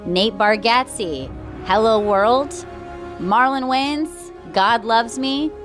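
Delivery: ordinary delivery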